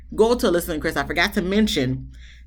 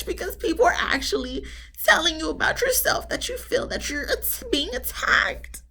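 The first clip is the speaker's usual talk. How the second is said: mock sobbing whisper; 11 semitones higher than usual. Mocking Alyssa's tears.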